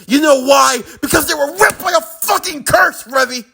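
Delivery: high, shouted explanation; anguished rage. Acted. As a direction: hurl the reason out in fury. Acted outburst about the curse.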